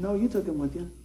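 normal talking voice

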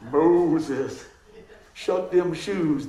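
low, slow drawn-out mock country God voice, shoes stretched; Buckrow version. Preacher jokes to the congregation, slow mock country God voice. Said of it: "that's the Buckrow version".

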